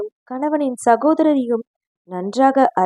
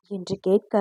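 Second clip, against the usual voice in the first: plain reading voice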